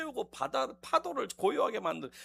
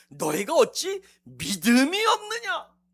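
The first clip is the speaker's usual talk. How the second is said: slow rebuke; 3 semitones higher, 7 dB louder, slower than usual. Acted. Jesus rebukes.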